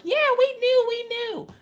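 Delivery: high, singsong "we knew"; mocking chat chorus. Chat mockingly admitting they knew.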